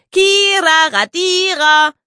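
put-on high, loud taunting girl's voice; a cheeky young girl jeering from downstairs; high-pitched child mocking her brother; shrill little girl. A narrator's reading. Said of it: "Lisas schrille Stimme".

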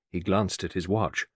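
ordinary delivery, nothing marked

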